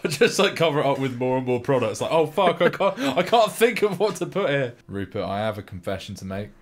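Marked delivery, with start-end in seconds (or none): 2.1-4.7 mock panic. Mimics panicking product-placement filmmaker.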